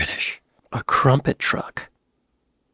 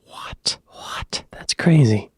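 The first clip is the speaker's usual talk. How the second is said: whisper; much slower than usual. Real, not acted. Stunned reaction to photos.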